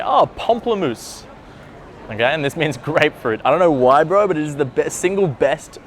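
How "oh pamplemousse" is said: pamplemousse said slowly and much higher, drawn out in French style; pamplemousse, savoured slowly on a high pitch like a French charmer; French word demo. Shown as a demonstration. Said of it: "I'll always say".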